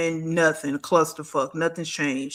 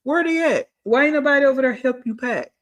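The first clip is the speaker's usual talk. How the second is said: much higher, pointed question; exasperated where-they-at; incredulous demand; 7 semitones higher than usual. Real, not acted. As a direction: call her out, pitched way up. Demanding where her friends are.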